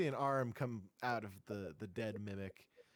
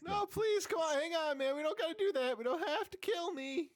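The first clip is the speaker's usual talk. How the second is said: high, whiny little demon begging for life; squeaky imp pleading, panicked; 4 dB louder than usual. Acted.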